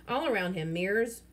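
plain reading voice

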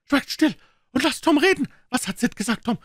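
put-on high, loud old aunt hushing; old aunt, impatient and eager; Aunt Polly.

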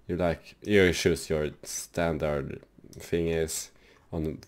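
usual speaking voice

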